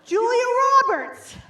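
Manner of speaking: high-pitched star introduction, falling at the end; mock announcer. Host to audience introducing a supposed presenter, mock-grand announcement. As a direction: announce the name like a big celebrity reveal.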